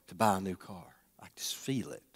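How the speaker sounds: unvoiced hush, low and far away; divine whisper. God whispering to him to buy a new car, hushed. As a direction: breathe it like a voice from heaven.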